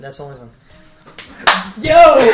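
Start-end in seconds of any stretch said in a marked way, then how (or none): 1.8-2.3 loud, high burst of hype; real excited shout. Real, not acted. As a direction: erupt at a big reveal. Erupts at next game reveal.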